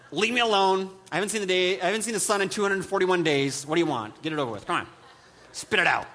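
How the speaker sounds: hammed-up irritable local; grumpy New Yorker. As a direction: bark it like sun-starved and fed up. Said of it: "So we're like".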